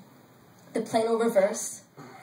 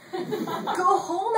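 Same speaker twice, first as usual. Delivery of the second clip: high-pitched pretend scream, not full volume; mock scream; 8 semitones higher than usual. A narrator's reading. Narrator begging women to stop using the power, mock scream. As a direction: plead it high, as if wanting to scream. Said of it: "I wanted to scream".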